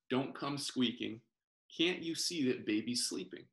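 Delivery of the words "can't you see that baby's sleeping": usual speaking voice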